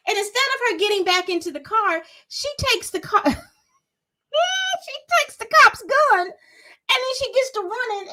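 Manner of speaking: breathless, can't believe what happened; excited retelling. Real, not acted.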